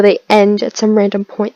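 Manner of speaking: ordinary delivery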